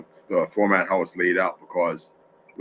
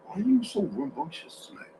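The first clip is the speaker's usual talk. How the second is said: higher, softer pet-talk voice; 8 semitones higher, 6 dB quieter than usual. Real, not acted. Streamer teasing his restless pet, mildly scolding. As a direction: playfully scold the pet in a lifted voice.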